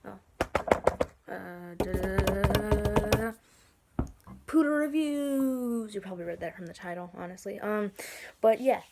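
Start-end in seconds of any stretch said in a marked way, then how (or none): 1.3-3.3 slow sung drum roll; vocal drum roll. Pretend. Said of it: "drum roll please". 4.5-5.9 high, slow grand announcement; show title announcement. Pretend. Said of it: "drum roll please".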